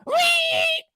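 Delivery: piercing high "whee"; long, very high held squeal; imitated whistle. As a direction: shriek like a whistle blowing. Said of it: "somebody give me a whistle".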